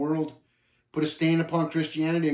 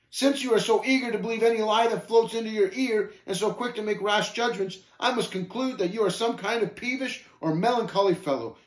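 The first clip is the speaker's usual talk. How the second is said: higher-pitched, affronted retort; wounded pride, pitched up and easing toward the end; great indignity; 6 semitones higher than usual. Acted. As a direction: huff through a haughty farewell. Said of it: "failing with great indignity at such cruel slanders".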